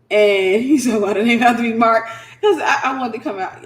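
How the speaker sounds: loud laughing aside. Real, not acted. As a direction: laugh through the words.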